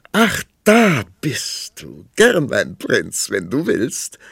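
high, slow, lilting princess voice; playful princess. A narrator's reading. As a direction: coo it like a spoiled young princess. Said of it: "sagte die Prinzessin aufwärts schauend".

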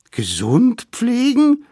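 very high, loud, drawn-out question; dismayed echo. A narrator's reading. Savages hear they must nurse the mammoth, dismayed. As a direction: squeal it back in disbelief.

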